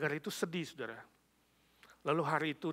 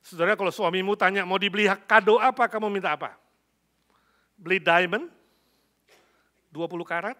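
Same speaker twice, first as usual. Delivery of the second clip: loud, raised preacher's question; preaching; 5 semitones higher, 14 dB louder than usual. Real, not acted. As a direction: throw a question out to the congregation. Preacher challenges the congregation. (berkhotbah lantang, bertanya pada jemaat)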